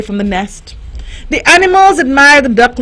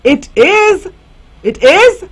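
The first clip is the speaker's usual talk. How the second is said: higher amazed questions; gasped surprise; 8 semitones higher than usual. A narrator's reading. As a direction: gasp at the discovery. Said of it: "gasped Dazzle".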